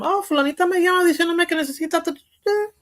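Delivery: high-pitched whiny mimicry in Spanish; whiny relative voice. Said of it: "they say that on social media all the time".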